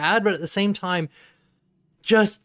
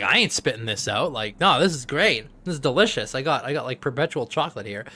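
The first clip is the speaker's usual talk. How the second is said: much lower, quieter smug voice; smug little brother; 7 semitones lower, 4 dB quieter than usual. Retold or imitated. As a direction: play the gloating kid savoring it. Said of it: "He's like".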